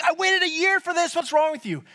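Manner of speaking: high, indignant mock scream; screaming in anger. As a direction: rant in a high, aggrieved voice. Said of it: "Screaming, yelling, swearing at him".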